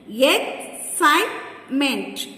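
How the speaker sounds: "Excitement" slowly in three beats, Ex, cite, ment; slow, broken-up "Excitement" for counting; syllable by syllable. Shown as a demonstration. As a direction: pause between the syllables of "Excitement". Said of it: "Three syllables".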